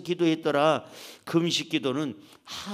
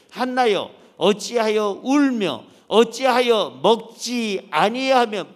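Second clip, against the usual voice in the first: 천천히 안타깝게 묻듯; 아내를 달래는 남편처럼 느리게; 다정한 남편; 5 semitones higher, 8 dB louder, slower than usual. Acted. 남편 엘가나의 말 낭독. (우는 아내를 달래는 남편의 느린 물음)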